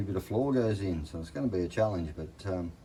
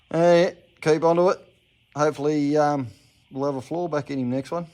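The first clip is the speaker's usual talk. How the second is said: excited; 7 semitones higher, 9 dB louder than usual. Real, not acted. Upbeat sign-off.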